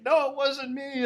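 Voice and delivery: high-pitched protesting voice; mock denial. Host acts a character denying the killings, high-pitched denial. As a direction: plead innocence in a comic high voice. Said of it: "he's like".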